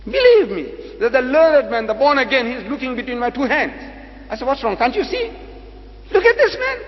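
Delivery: high, loud exclamation of disbelief; loud, high-pitched appeal to the crowd; loud incredulity. Real, not acted. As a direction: pitch it right up, exasperated and amazed. Exclaims at the baffled learned man.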